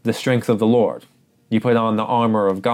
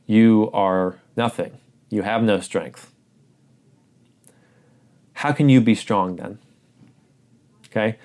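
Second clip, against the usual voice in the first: ordinary talk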